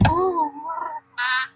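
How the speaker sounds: ordinary talk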